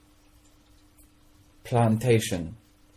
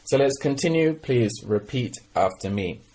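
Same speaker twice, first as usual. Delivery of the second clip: ordinary talk, nothing marked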